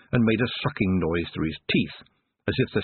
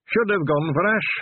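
much higher, dry dwarf voice; second dwarf; 10 semitones higher, 3 dB louder than usual. Acted. Second dwarf chiming in about the wood, dry. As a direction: chime in with weary disapproval.

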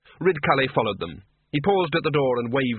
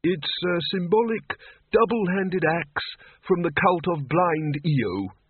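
put-on slow, higher, mild old man; helpful old wizard; 6 semitones higher, slower than usual. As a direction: explain it patiently. Old Windle helpfully identifies object.

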